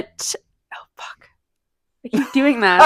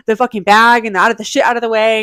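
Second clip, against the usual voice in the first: ordinary delivery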